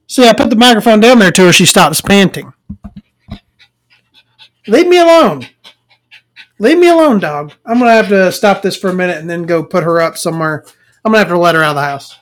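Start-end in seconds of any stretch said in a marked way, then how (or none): 4.7-7.5 high, loud shout to get the dog off her lap; yelling at the dog. Real, not acted. Owner yelling at her dog jumping in her lap, loud high shout. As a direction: snap it out high and loud, twice.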